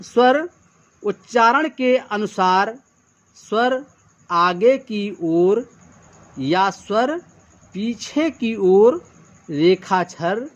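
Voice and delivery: slow, deliberate reading of a definition; slow dictation. Shown as a demonstration. Teacher dictating the shorthand rule slowly to the class. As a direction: read each phrase slowly so students can write it. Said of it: "तो क्या कहा है".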